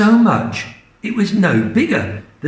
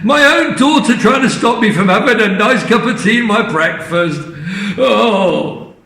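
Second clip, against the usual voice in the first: aggrieved Grandma; 6 semitones higher than usual.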